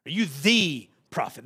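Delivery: "the" hit hard and stretched to "thee"; stress on THE. Shown as a demonstration. Preacher pointing out the definite article, emphatic "the". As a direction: punch and hold "the" before prophet. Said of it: "That definite article".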